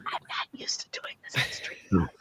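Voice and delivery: low hushed whisper. Real, not acted. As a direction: whisper so the mic won't catch it. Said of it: "Why are we whispering?".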